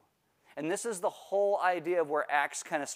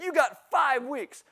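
stern mom; 9 semitones higher, 3 dB louder, slower than usual. Retold or imitated. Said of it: "She said".

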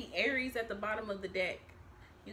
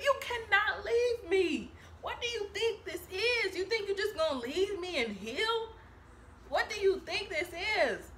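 higher, louder, laughing indignation; mock outraged ex; 8 semitones higher, 4 dB louder than usual. Jealous ex scolding a partner who moved on, mock outrage.

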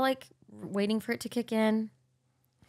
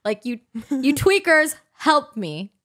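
louder, slow, panicky yelling at the lawn mowers; frantic plea; 5 semitones higher, 6 dB louder, slower than usual. Retold or imitated. Teen begging stepdad and son mowing at midnight, loud panicked. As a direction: beg them to sit down, half shouting. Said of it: "And I'm like".

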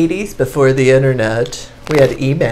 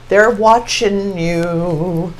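slow ominous; much slower than usual. Real, not acted. Slow spooky read.

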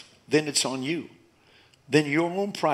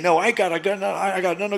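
mock stubborn protest; 7 semitones higher, 5 dB louder than usual. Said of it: "a weird thing".

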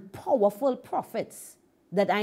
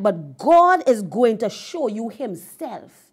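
loud, slow retold prophecy; emphatic declaration; 7 dB louder, slower than usual. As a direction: proclaim it slowly and forcefully, as a preacher. Preacher declares God will reveal himself.

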